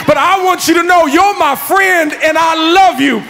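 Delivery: loud, high, heartfelt plea; preaching at full force. Acted. Preacher voicing Tommy's plea to his friend, loud and fervent. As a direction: pour it out like a sermon climax.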